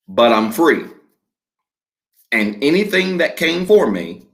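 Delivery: slow, measured declaration with long pauses for the congregation to repeat; call-and-response declaration, slowed way down; slow declaration reading. Real, not acted. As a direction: read each phrase slowly and leave room for echoes. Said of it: "I'm gonna read it slowly".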